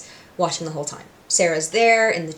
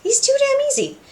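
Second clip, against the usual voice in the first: even higher, squeakier gloat; hammed-up villain gloat pitched way up. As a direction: push the smug gloat up into a giddy squeal. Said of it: "Alistair's just like".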